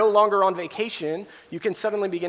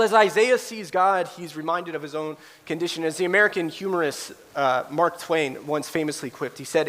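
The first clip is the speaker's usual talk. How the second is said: ordinary talk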